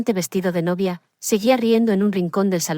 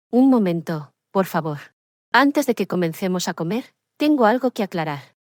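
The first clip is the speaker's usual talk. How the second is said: usual speaking voice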